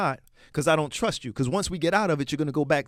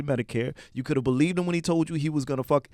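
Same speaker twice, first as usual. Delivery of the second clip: normal talking voice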